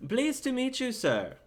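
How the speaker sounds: high, polite gentleman; Kane's voice. Acted. Kane greeting the Templar, high polite voice. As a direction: offer the hand with courtesy.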